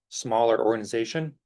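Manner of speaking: ordinary talk, nothing marked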